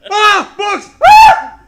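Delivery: very high, loud shrieks; hammed-up terrified yell; mock scream. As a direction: shriek at the bookcase in fake horror. Dad screaming at bookcase.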